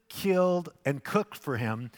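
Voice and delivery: ordinary delivery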